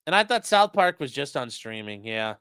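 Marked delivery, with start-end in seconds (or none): none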